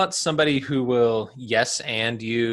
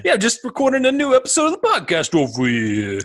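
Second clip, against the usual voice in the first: put-on high, loud New Jersey accent; 10 semitones higher, 4 dB louder than usual. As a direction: brag like a Jersey guy. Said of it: "my New Jersey accent".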